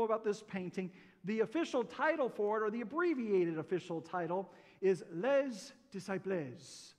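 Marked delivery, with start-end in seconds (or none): 5.1-6.9 hammed-up amateur French accent, drawn out slowly. Shown as a demonstration. Preacher giving a painting's official title, mock French accent. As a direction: put on a slow, homemade French accent. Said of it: "that's my French accent".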